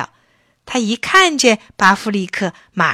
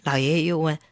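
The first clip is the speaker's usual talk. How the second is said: ordinary delivery, nothing marked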